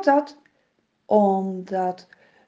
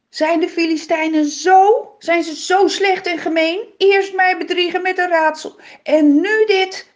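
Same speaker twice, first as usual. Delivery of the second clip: higher, louder raging outburst; furious; 6 semitones higher, 7 dB louder than usual. A narrator's reading. Simson rages at the father about the Philistines, louder and higher. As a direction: Simson boils over, vowing revenge. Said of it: "Hij wordt razend".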